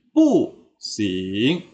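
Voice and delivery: "pu sing" slow, sharp fall then rise; fourth tone then second. Shown as a demonstration. Teacher repeating correct reading, "pu sing" falling then rising. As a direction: drop "pu" hard, glide "sing" up. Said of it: "tidak ya".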